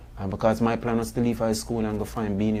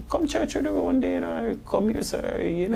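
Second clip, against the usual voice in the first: higher, eager voice of inviting friends; coaxing invitation; 8 semitones higher, 4 dB quieter than usual. Retold or imitated. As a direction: raise the pitch to coax him along. Friends inviting him to church.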